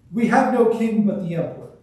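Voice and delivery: forceful public reply; loud retort. A narrator's reading. Priests answer Pilate in crowd scene.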